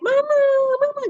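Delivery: mock a small boy yelling for mum; imitated child's yelling. Boy yelling for mum, high mock shout. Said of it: "kadang teriak".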